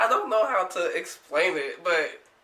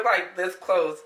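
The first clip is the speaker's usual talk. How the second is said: ordinary delivery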